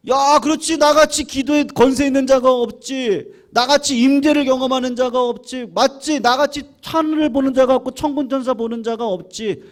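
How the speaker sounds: high, loud boastful self-talk; the saint's swollen pride, voiced loud and high; proud inner voice. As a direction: puff yourself up, gloating. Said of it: "마귀의 나팔이".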